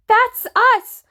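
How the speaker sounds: high, loud wailed cry. A narrator's reading. Tommy tells the bridge which craft is theirs, wailing. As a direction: wail it out at full pitch. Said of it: "Tommy wailed".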